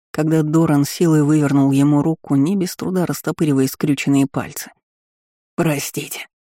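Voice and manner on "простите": usual speaking voice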